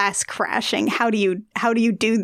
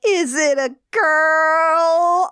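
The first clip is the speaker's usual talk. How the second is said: high, slow, loud prying mom; 7 semitones higher, 4 dB louder, much slower than usual. Retold or imitated. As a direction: ask like a nosy mom. Mom voiced in retelling.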